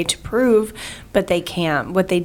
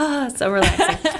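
blissful sigh; 6 semitones higher than usual. Retold or imitated. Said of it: "they're like".

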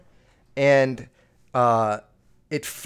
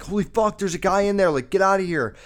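high, loud panicked yell; warning the cop there's a man inside, yelling; retold scream; 5 semitones higher, 5 dB louder, faster than usual. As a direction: shout the warning like you just saw him. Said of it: "So I'm screaming".

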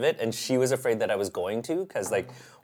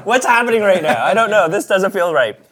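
laughing, higher and louder; real laughter; 7 semitones higher, 9 dB louder than usual. Real, not acted. Guest caught out by the host's joke, laughing. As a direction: laugh through the embarrassment.

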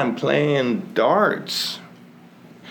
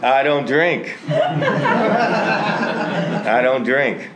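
defensive denial, louder, laughing through it; 7 semitones higher, 4 dB louder than usual. Retold or imitated. Husband denying to wife he drank, laughing. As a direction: protest your innocence while cracking up.

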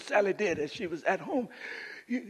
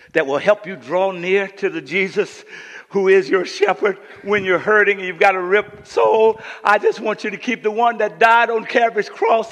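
sermon swelling louder, phrase on phrase; building preaching; 2 semitones higher, 13 dB louder than usual. Acted. Preacher pointing the hurting to Jesus, building preaching. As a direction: build steadily toward a shout.

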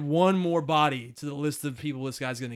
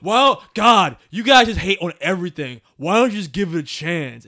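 louder, whiny internet critic; imitating an annoying online commenter, louder; mocking internet guy; 9 dB louder than usual. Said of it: "Don't be that internet guy".

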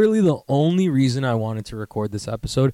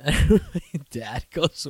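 normal talking voice

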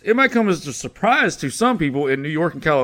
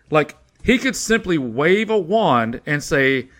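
normal talking voice

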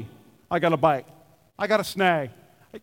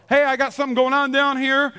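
call to crew; 3 semitones higher than usual. Shown as a demonstration. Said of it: "supposed to yell something to the crew".

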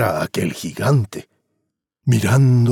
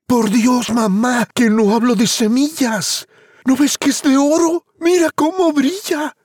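put-on high, pleading boy voice; eager boy; 14 semitones higher than usual. Juan insists to his mother the stalk is gold. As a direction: insist excitedly like a kid.

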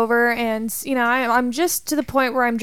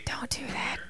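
whisper; 14 dB quieter than usual. Real, not acted. Whispered scold to friends.

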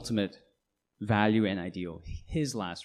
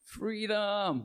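higher-pitched quoted battle cry of William Wallace, lightly done; mock Braveheart cry; 10 semitones higher than usual. Preacher quoting William Wallace's last cry, mock high cry. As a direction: toss off a brief mock rallying cry. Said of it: "his final cry before his death".

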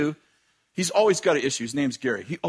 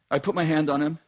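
normal talking voice

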